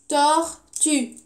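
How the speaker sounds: "Tortue" in two separate beats, tor-tue; split into syllables. Shown as a demonstration. Teacher counting syllables for the class, "Tortue" syllable by syllable. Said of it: "Combien de syllabes pour tortue?".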